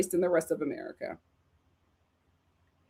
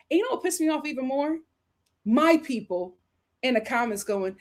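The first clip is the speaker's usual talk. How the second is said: ordinary talk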